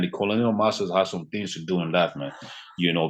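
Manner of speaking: normal talking voice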